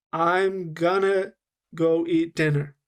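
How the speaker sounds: slow, "I'm gonna go eat dinner" linked but drawn out; slowed-down linking. Shown as a demonstration. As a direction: stretch out the linked words slowly. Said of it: "let me slow down what I did".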